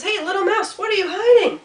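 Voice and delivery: high, loud, bouncy voice; excited Rabbit. Acted. Rabbit asks Mouse what is hidden, high and excited. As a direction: burst out with eager curiosity.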